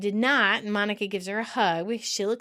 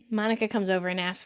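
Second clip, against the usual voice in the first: ordinary delivery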